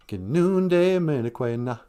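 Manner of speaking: "Gi nunde minekwe na" slow with the na added; slow question demo. Shown as a demonstration. To the kids, teaching how to ask if someone's thirsty. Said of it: "And then add an "ina".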